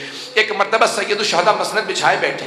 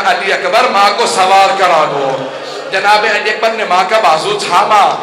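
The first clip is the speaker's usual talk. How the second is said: louder, shouted mourning recitation; preaching at full force; 2 semitones higher, 7 dB louder than usual. Acted. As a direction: call out each name in rising grief. Climactic majlis recitation.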